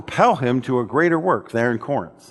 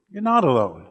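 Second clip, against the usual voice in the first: gentle echo. Real, not acted.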